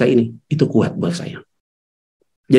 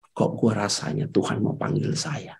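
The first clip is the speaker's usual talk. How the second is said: whisper; 6 semitones lower, 12 dB quieter than usual. Retold or imitated. Crying wife fears dying.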